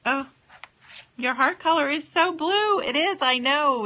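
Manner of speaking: high, loud thrilled gushing; excited delight. Real, not acted. Excited about viewer's heart color.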